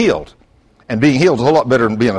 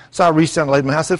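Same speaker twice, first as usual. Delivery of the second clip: usual speaking voice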